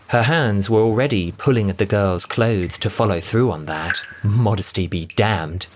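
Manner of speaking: ordinary delivery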